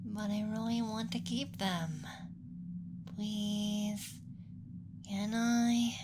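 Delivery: wheedling, rising plea; pleading. Acted. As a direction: plead sweetly to keep a pet. Begging to keep human as pet.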